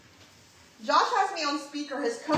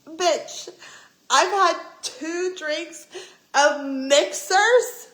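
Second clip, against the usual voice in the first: louder, drawn-out words in disbelief; slow, incredulous rise on "mixers"; retold outrage; 5 semitones higher, 7 dB louder, much slower than usual. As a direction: spell it out slowly and loudly, indignant. Retold outraged complaint to the bartender.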